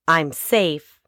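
usual speaking voice

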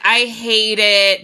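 very slow, stretched complaint; drawn-out disgust. Retold or imitated. Friend reacts to the snake, slow and disgusted. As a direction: drag out the dislike. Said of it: "I was like".

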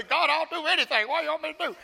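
high, loud, impassioned outburst; emotional cry to God. Retold or imitated. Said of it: "I get emotional".

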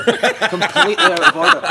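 high, loud, drawn-out retold outrage; mock outrage. Goalie condemning the penalty spot prank, hammed-up outrage.

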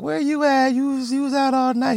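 put-on high, nagging girlfriend voice; a girlfriend demanding answers, high and nagging; mock girlfriend. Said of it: "she was like".

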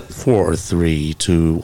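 low, measured countdown voice; imitated count-in. Imitates count-in heard at the show.

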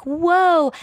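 high amazed whoa; awed whoa. Retold or imitated. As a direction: let out a crowd's amazed whoa. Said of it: "they're like".

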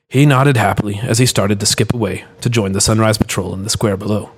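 usual speaking voice

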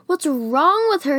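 screamed. A narrator's reading. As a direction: scream it as an accusing question. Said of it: "he screams".

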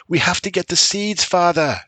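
put-on high child's shout. A narrator's reading. Son shouting to father about the seeds, high. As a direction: shout it over the rain. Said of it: "shouted Tuck".

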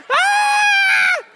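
long high-pitched mock scream; hammed-up mother's shriek; panicked scream. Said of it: "freaking my mom out even more".

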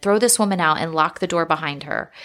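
ordinary delivery

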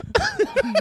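high yippy laugh; jackal laugh. Real, not acted. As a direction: cackle like a jackal. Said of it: "I sound like a jackal when I laugh".

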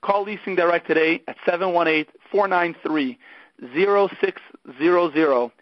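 brisk upbeat radio ad read; sponsor ad read. Acted. Podcast host reading a car leasing sponsor message, upbeat ad voice. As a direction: sell the leasing company like a radio spot.

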